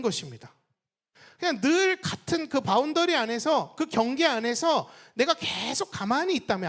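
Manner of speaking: loud, punchy sermon peaks on 사업 열심히 합니다; fervent preacher; preaching at full force. Acted. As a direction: hammer each point home to the church.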